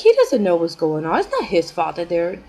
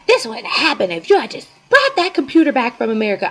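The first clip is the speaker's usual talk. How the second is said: going off; 4 semitones higher, 5 dB louder than usual. Retold or imitated. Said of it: "she starts going off".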